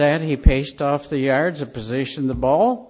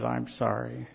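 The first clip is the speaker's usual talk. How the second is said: much softer, lower, broken apology; husband apologizing to his wife, softly and tearfully; 3 semitones lower, 7 dB quieter than usual. Retold or imitated. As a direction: say it softly as if crying. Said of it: "and he cried".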